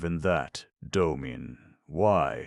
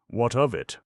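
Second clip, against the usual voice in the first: higher and louder, a sharp dismissive challenge; defiant retort; 7 semitones higher, 5 dB louder than usual. Acted. Domin retorts to Busman's worry, defiant higher challenge. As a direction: throw the question back at him.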